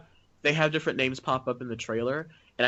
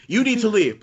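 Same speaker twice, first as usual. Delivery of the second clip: loud, higher quoted order; mock Stu line; 8 semitones higher, 7 dB louder than usual. Mimicking Stu's order from the film, loud and high.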